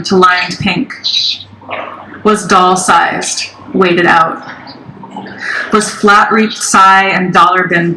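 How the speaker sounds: slow, measured recital with long pauses; deliberate, spaced-out poem cadence; poetry reading. Acted. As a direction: draw out each line and let it drop. Reads third poem.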